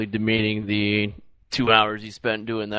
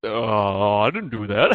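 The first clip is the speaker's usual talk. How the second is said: ordinary talk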